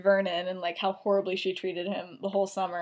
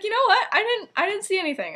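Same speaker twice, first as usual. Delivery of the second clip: high, hurried backing out; quick, high-pitched mock denial; feigned ignorance; 11 semitones higher, 3 dB louder, faster than usual. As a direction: play innocent in a much higher voice.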